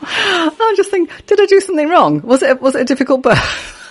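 mock whiny self-pity. Mock self-pity over one name.

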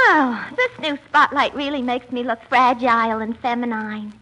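ordinary talk

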